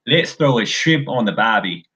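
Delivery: playful put-on Australian accent; hammed-up Aussie barbecue line. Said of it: "That's all I got for Australian accents".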